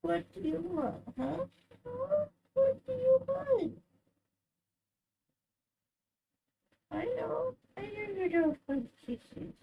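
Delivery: high, soft coo; doting owner soothing her cat. Real, not acted. Cooing to cat.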